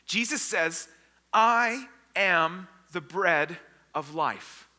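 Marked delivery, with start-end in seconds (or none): 1.3-4.7 very slow, word by word; solemn declaration. Acted. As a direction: declare it with weighty pauses. Jesus's solemn declaration, acted.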